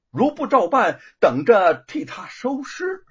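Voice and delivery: kidnappers' note read as a loud threat. Acted. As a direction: lean hard on the threat. Menacing end of ransom note.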